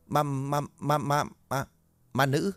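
Mạnh stammering to the guard in fright; terrified stammer. Acted. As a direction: trip over every word in fear.

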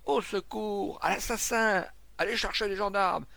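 high, shouted alarm; calling for help. A narrator's reading. Crowd calling for gendarmes after a killing, alarmed shouting. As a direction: bellow for help across the street. Crying murder, calling for police.

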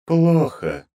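high, curt, icy word; cold goddess verdict. Acted. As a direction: drop it like a death sentence. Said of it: "повисла звенящая тишина".

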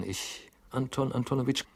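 stammering. A narrator's reading. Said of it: "antwortete stotternd Herr Goljetkin".

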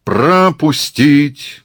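loud, drawn-out high command; thunderous bellow. Acted. Murat orders crowd to make way, bellowing. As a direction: roar it slowly over the crowd. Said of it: "громовым голосом орёт Мурат".

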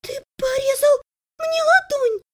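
very high, wounded complaint; shocked outcry. A narrator's reading. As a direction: accuse your brother in a high voice. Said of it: "потрясённо выдохнула она". (потрясённо, тонким голосом)

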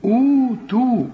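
high, drawn-out long oo in أوتوا; lengthened waw. Shown as a demonstration. Teacher shows how to lengthen it, long waw in أوتوا. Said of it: "هنمدها".

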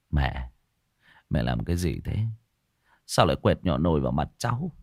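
low, soft, troubled reproach; reproachful. A narrator's reading. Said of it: "anh Nghĩa cau mày trách". (trách móc khẽ, giọng trầm)

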